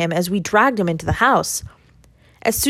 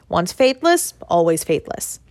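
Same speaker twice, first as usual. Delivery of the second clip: usual speaking voice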